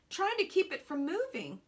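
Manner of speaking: plain reading voice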